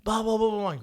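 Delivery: loud, high mock rant in nonsense syllables; frantic yelling. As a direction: imitate a yelling driver with blahs. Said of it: "He's yelling, frantic".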